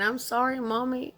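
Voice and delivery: imitated crying child.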